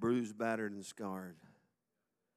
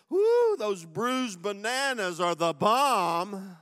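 hammed-up slow louder rave, Woo stretched out; mock delight; 9 semitones higher, 13 dB louder, slower than usual. A pretend shopper at the fruit section, hammed-up excitement. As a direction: oversell bruised bananas like a treat.

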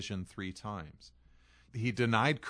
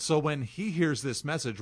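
ordinary talk

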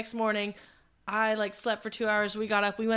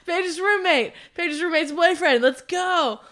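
higher, louder roll call; 7 semitones higher, 5 dB louder, slower than usual. Retold or imitated. Storyteller rounds up friends to leave, calling out. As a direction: summon the group to get moving. Calls group to leave, retold.

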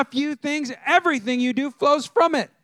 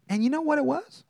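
ordinary delivery

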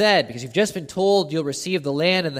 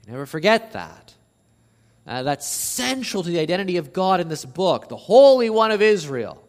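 normal talking voice

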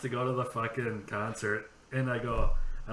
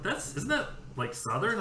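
put-on much higher, puzzled girl voice; lightly hammed ditzy blonde, confused; mock ditzy confusion; 9 semitones higher than usual. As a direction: sound baffled and airheaded.

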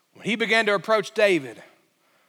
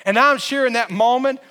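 ordinary delivery

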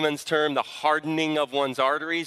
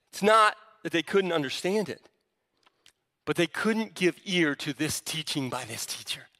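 usual speaking voice

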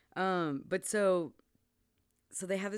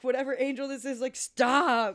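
raised, loud plea to stop; acted demand; 6 semitones higher, 7 dB louder than usual. Friend retells hero ordering unseen angel away, loud demand. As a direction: build to a forceful stop.

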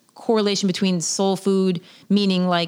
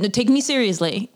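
ordinary delivery

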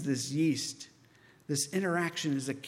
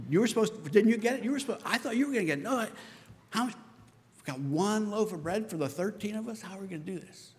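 fast, higher, squabbling blame; bickering disciples; 5 semitones higher, faster than usual. Acted. Disciples arguing in the boat about the bread: quick, high squabble. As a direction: rattle off the blame, talking over each other.

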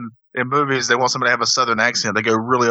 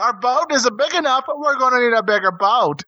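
high, overacted fake Southern accent; bad Southern acting; 10 semitones higher than usual. Would-be actor mangles a movie line, fake Southern accent. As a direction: ham up a film line, Southern-style. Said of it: "that's how I would always act".

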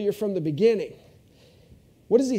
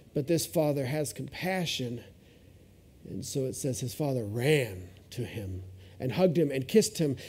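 ordinary talk

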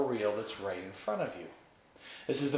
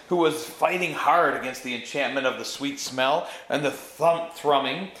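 ordinary delivery, nothing marked

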